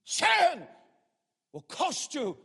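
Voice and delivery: high, loud, thundering single word; preaching shout. Acted. Preacher warning the church about sin, thundering shout. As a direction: hurl the word Sin at the congregation.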